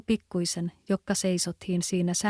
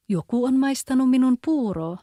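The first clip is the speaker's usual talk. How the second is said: louder, higher gruff papa bear; rough voice; 5 semitones higher, 6 dB louder than usual. A narrator's reading. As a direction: boom it out as the rough father bear. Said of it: "sanoi karhufaari krouvilla äänellä". (karhean karhuisän ääni, kovempi ja korkeampi)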